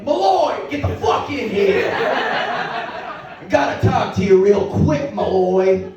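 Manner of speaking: loud gruff bark at his cop; chief yelling. Retold or imitated. Angry police chief summoning Malloy into his office, loud. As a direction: boss hollering Malloy into the office.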